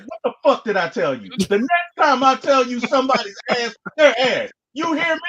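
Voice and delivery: hammed-up mock rant at me; quoting angry brother. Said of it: "I'm a quote him".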